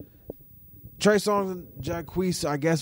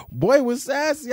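ordinary talk, nothing marked

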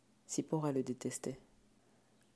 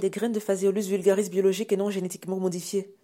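high, shouted answer; panicked blurt; 6 semitones higher, 10 dB louder than usual. Acted. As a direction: yell out the first thing that saves you. Son blurts answer under pressure. (réponse criée dans l'urgence)

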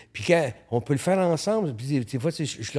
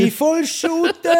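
much higher, louder, hammed-up yell; excited shout; 7 dB louder than usual. Photographer shouting his motto to the host, hammed-up. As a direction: bellow it like a battle cry. Repeats it as a mock rallying cry.

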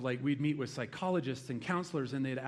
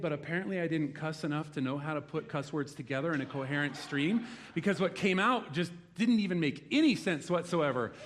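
ordinary delivery

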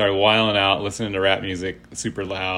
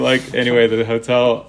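ordinary delivery

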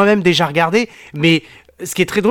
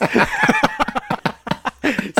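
high laugh; 3 dB quieter than usual. Real, not acted. Joke about the frame's price.

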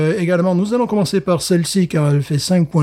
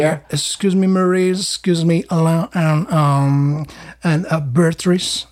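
mock American accent introducing the family; light jokey American accent; put-on American accent; 2 semitones higher, slower than usual. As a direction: play a Frenchman faking an American accent. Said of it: "j'ai appris un peu l'accent américain".

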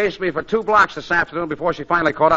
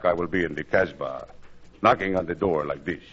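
put-on French lover accent; 12 semitones lower, 7 dB quieter than usual. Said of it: "Listen to this, Gracie".

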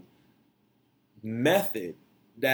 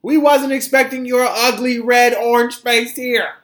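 high, loud mocking brush-off; mocking dismissal; 12 semitones higher, 9 dB louder than usual. Real, not acted. Host mocking Trump for skipping the inauguration, high. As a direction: sneer it with glee.

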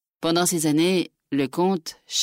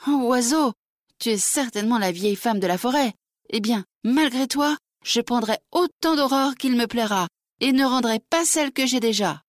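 put-on higher, defiant young girl; mildly obstinate girl defying the bird; stubborn; 7 semitones higher than usual. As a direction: dig in your heels and refuse. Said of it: "répétant avec obstination".

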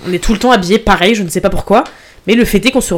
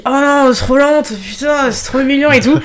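put-on drunk whine; 6 semitones higher than usual. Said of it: "et j'ai dit".

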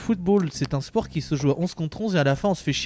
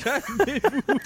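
much higher cartoon pitch; high put-on voice; 13 semitones higher, much slower than usual. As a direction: squeak it out comically. Joking with the hosts.

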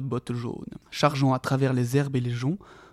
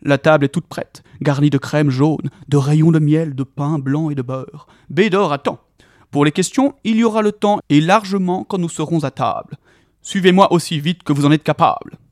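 loud jolly welcome; jolly Tom inviting hobbits home loudly; hearty invitation; 3 semitones higher, 8 dB louder than usual. A narrator's reading. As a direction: boom out the invitation.

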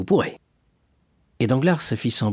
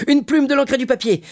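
loud, high-pitched cry; shouted order; 5 dB louder than usual. A narrator's reading. Fernand takes up the call to the waiter, crying out. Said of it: "cria à son tour Fernand".

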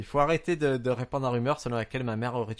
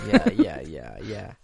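mock porn actor; 5 semitones lower, 5 dB louder, much slower than usual. Showing English learned from Pornhub, mock porn actor. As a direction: parody adult-film English, dropping to a low murmur. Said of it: "j'ai appris à parler anglais sur Pornhub".

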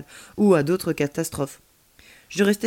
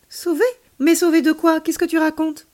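high, loud, startled questioning; baffled; 10 semitones higher, 6 dB louder than usual. A narrator's reading. Child hearing Earth must be saved, baffled. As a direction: demand an explanation, taken aback.

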